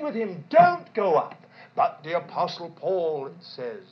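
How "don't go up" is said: loud plea. Retold or imitated. Church pleading with Paul.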